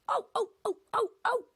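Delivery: squeaky high trucks crying oh; high squealing cries from silly trucks; screaming trucks. Acted. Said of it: "screamed the trucks".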